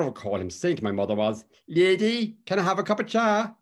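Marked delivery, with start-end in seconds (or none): 1.7-3.5 much higher, louder Cork lilting call; sing-song Cork accent, calling at the door. Retold or imitated. Dan asking the mother for tea, high Cork lilting call. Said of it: "he said it in that distinctive Cork lilting tone".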